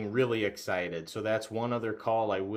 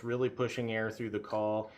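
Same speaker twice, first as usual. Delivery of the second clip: usual speaking voice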